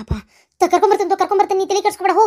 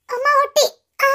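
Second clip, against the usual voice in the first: pained cry; 6 semitones higher than usual. Acted. Pregnant girl hurt lifting.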